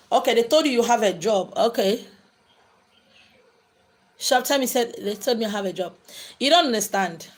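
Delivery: ordinary talk, nothing marked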